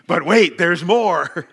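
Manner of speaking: loud, bright TV-ad hype on but wait; hammed-up late-night commercial announcer; playful mock sales pitch to the congregation; infomercial pitch. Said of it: "it sounds like one of those, uh, commercials".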